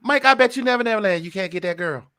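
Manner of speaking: high, loud, quick Chris Tucker voice; Chris Tucker impression. Host imitates Chris Tucker teasing [name], high fast squeal. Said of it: "like Chris Tucker talking to [name]".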